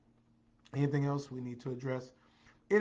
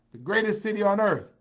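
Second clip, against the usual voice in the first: loud, higher hometown boast; proud proclamation; 7 semitones higher, 10 dB louder than usual. Real, not acted. Proudly hyping the home city.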